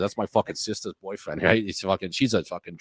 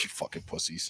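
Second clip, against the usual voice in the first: hissed under the breath, mocking the kids; a sneering whisper; 8 semitones higher, 15 dB quieter than usual. Uncle hissing at nervous kids, a sneering whisper.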